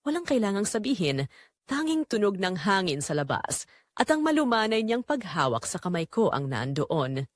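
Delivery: plain reading voice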